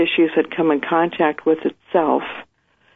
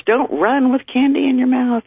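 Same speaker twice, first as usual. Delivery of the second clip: parent warning; 8 semitones higher than usual. Retold or imitated.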